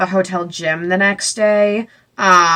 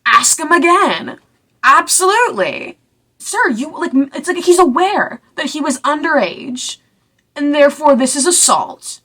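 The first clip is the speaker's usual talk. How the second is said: indignant; 7 semitones higher than usual. Real, not acted. Indignant commentary.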